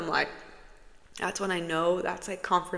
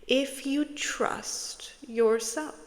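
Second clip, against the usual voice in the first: slow whispered encouragement; slow it right down, whispering; 6 semitones higher, much slower than usual. Real, not acted. Closing reassurance.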